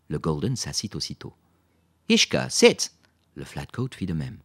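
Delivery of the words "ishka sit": loud, high-pitched order; firm dog command. A narrator's reading. Lisa makes the Golden sit, commanding. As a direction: call it out sharply to a dog.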